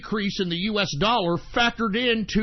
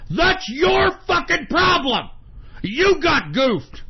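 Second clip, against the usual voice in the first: angry shout; 6 semitones higher, slower than usual. Real, not acted. Host yelling at indebted millennials, angry slow shout. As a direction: yell it at the debtors with contempt.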